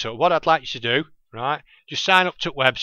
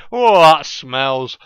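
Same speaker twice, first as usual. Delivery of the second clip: disgusted laugh; 2 semitones higher, 10 dB louder, much slower than usual. Real, not acted. After farting on mic.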